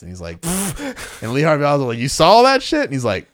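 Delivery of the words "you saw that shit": high, loud disbelieving question; shocked question. Retold or imitated. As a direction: blurt it out in shock. Oswald's shocked question, retold.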